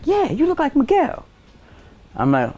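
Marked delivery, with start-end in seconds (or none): 0.1-1.2 put-on voice. Folks quoted in put-on voice.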